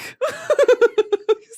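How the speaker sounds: loud, high peal of laughter; laughing. Real, not acted. Streamer cracking up at own impression, loud laughter. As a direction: burst into high, helpless cackling. Cracks up after saying duck.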